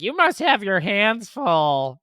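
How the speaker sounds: high, slow, mocking sing-song; snide stranger. Parent mocking a nosy stranger, high slow snide voice. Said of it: "Some people are nasty right then".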